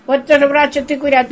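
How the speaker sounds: normal talking voice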